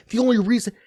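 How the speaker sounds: usual speaking voice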